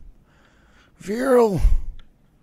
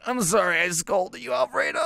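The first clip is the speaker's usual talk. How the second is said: normal talking voice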